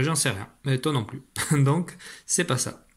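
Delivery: ordinary delivery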